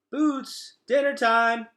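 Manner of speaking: high, loud call across the camp. Acted. Gregor calling his sister Boots over for dinner, loud high call. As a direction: call the little sister in to eat.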